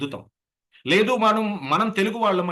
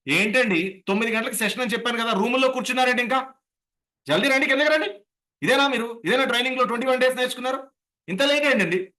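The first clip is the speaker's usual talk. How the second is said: scolding; 7 semitones higher than usual. Retold or imitated. A trainer snapping at trainees who came down late. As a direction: rap them for being late.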